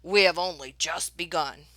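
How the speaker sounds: a different, stuffy man's voice; pompous passenger grumbling; grumbling gentleman. Acted. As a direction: complain down your nose at her. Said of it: "Mister Hooper grumbled to Mama".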